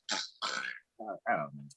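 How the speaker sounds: usual speaking voice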